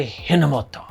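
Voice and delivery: Hinomoto in a light Japanese accent. Shown as a demonstration. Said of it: "you have to say it with that accent".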